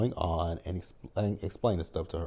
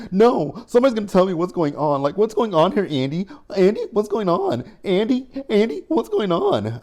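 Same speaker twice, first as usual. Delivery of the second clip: high and loud, firing the question at Andy again and again; imitating Penelope pestering Andy in a high, loud voice; frantic demanding; 12 semitones higher, 9 dB louder than usual. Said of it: "if she repeats that one more time".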